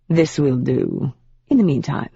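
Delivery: lightly voiced proper British demon, smug and unhurried. A demon in proper British, teasing Rachel about secrets.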